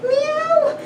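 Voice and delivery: very high mewing cat imitation; high meow. Owner meowing at her cat, high kitten mew.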